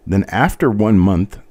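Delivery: normal talking voice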